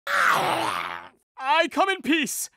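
drawn-out shout, every word stretched; slow pleading shout. Acted. Villain boy pleading with the suspicious girls, slow stretched shout. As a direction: stretch it out to prove you mean no harm.

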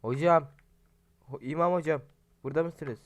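loud call for the hocam. Real, not acted. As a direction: shout into the house for the imam. Calling for the imam.

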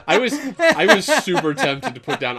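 high, loud talk through laughter; real amused laughter in the voice; laughing. Real, not acted. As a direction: say it while cracking up. Laughing about the questionnaire option.

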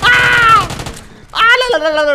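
sudden high loud cry of alarm; startled yelp. Real, not acted. Gamer gets ambushed and yelps high and loud in surprise. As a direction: jolt up in real shock.

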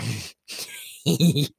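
high-pitched clown giggle; creepy little snicker; gleeful cackle. Acted. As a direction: giggle to yourself, mockingly. Clown mocks the fancy one.

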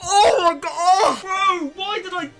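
groaning through real pain, drawn out; wincing, it really stings. Real, not acted. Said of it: "that hurts so much".

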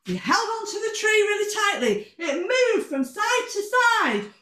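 high, quick, suspenseful storytelling; excited narration. A narrator's reading. Storyteller as Marvin clings to the tree, excited and high. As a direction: keep the danger up in a high voice.

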